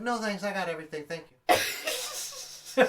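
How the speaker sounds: high, chirpy over-polite refusal; Ned Flanders. Retold or imitated. Passerby politely refusing a threatening stranger, high and over-polite. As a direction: decline in a squeaky goody-goody voice. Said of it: "What up, fucking Ned Flanders?".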